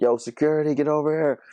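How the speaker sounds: high, playful call across the lobby; mock call for security. Friend teasing the girl, a high playful shout for security. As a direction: summon the guards as a joke.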